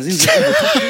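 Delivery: high, loud, laughing sarcastic question; laughing disbelief. Retold or imitated. Telling the policewoman off, laughing loud and high. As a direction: crack up mid-retort, mocking the question.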